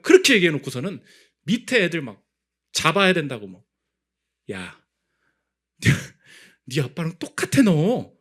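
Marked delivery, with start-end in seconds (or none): 4.5-8.1 mock rebuke. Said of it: "그 얘기 해주고 싶더라고요".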